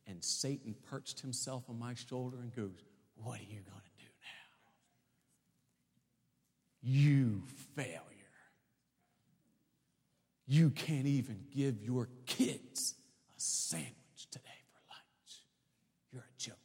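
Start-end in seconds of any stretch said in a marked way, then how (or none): 3.2-4.7 low, hushed taunting whisper; a sinister whisper right into the ear; whispering devil. Acted. Satan perched on the preacher's shoulder, taunting him in a whisper. 6.8-8.0 taunting devil. Acted. Satan taunting on his shoulder. 10.5-16.6 slow, hushed jeer sinking to a whisper; taunting devil. Acted. Satan needling the struggling student, slow and whispered.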